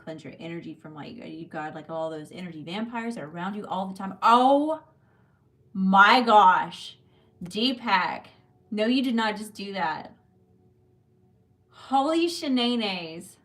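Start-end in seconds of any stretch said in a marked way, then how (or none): 4.2-10.1 loud, high burst of real disbelief; can't believe what a viewer just did; shocked surprise. Real, not acted. Surprise at huge live donation. 11.7-13.4 slow, loud and higher in amazement; stunned exclamation. Real, not acted. Reacting to huge donation.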